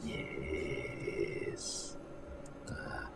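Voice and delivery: whisper. Real, not acted. Whispered aside.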